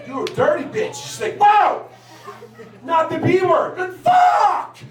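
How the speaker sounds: ordinary talk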